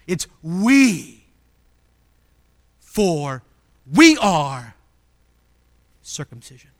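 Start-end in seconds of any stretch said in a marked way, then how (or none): 0.4-1.1 WE held long and leaned on; stretched, falling WE; emphatic WE. Shown as a demonstration. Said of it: "It's emphatic". 3.9-4.7 WE punched loud before ARE; strong opening stress on WE; emphatic WE. Shown as a demonstration. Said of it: "It's emphatic".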